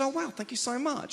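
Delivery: high-pitched gushing gratitude; retold delighted thanks; flattered thanks. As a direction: gush it high, taken aback. Flattered comic thanking his admirers.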